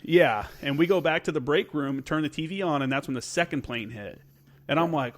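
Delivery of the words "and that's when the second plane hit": usual speaking voice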